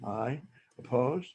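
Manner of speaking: ordinary talk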